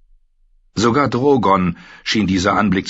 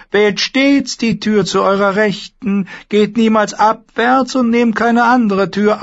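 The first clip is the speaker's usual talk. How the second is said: very high, loud, eerie warlock voice; a thin, uncanny sorcerer; 4 dB louder than usual. Acted. The warlock Pyat Pree bars the way, high and eerie.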